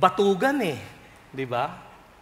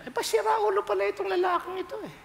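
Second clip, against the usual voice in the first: high, fast mocking voice; scoffing relatives; 13 semitones higher, faster than usual. The dead man's relatives scoff at Jesus, high and fast. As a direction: sneer that this man is crazy. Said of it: "siguro inisip niyo".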